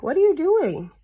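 high, lilting puzzled question; puzzled spider. Acted. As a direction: ask it as a baffled spider, pitch jumping up.

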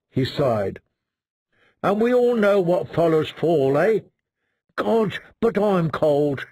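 deadpan doom, savoring every gloomy joke; gloomy Dolorous Ed. Acted. Dolorous Ed's lines.

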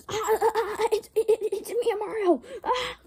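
high, loud giggling stammer; forced into the Mario line, laughing; laughing through the line. Acted.

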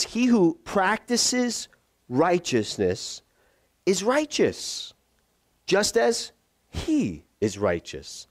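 very slow, each phrase set apart; measured, emphatic, drawn-out reading; deliberate verse reading. Shown as a demonstration. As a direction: read the scripture slowly with weighty pauses.

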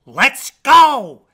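loud, high duck quack; big quacked rallying cry. A narrator's reading. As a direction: quack it out at full volume. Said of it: "quacked Ducky Lucky".

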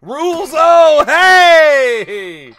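loud, high, drawn-out holler; big high celebratory shout; shouted greeting. Real, not acted. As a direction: holler the name as they finally appear. Greets winner who finally shows up.

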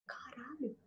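whispered oath in disbelief; startled whisper of fear; whispered curse. Retold or imitated. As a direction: breathe it out in shock. Shock, thinks she died.

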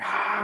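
high, soft "ahh" of a crowd; crowd roar imitation. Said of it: "the crowd's going".

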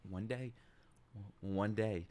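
very soft, low repetition; quiet disbelief. Real, not acted. Viewer taking in the twist, quiet disbelief. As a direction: murmur it to yourself, stunned. Repeats phrase, building to outburst.

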